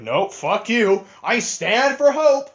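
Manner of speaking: lightly voiced Rey, dragged out and lifted; a mocking take on a noble movie hero; mock heroic Rey. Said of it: "Rey is like".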